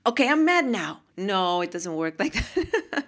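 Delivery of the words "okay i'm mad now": higher-pitched pretend mad announcement; mock sudden anger. Mock switching anger on by choice.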